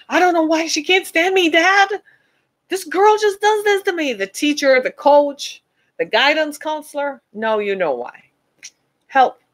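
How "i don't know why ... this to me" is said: higher pleading daughter's complaint; hurt daughter to dad. Retold or imitated. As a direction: daughter begging Dad to understand, pitched up. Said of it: "start calling, talking about why this woman hates me".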